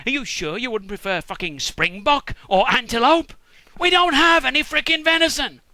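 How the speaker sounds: sarcasm building to a high, loud outburst; mounting comic exasperation; exasperated. Retold or imitated. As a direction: blow up at an absurd request. Acted son exasperated at venison request.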